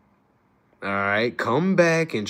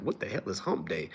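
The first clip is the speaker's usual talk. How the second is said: much softer, low bemused question; puzzled aside; 7 semitones lower, 11 dB quieter than usual. Retold or imitated. Recalling his confusion at work, soft bemused question. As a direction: mutter it, puzzled. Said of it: "I was like".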